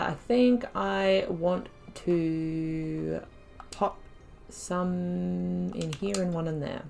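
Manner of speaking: ordinary talk, nothing marked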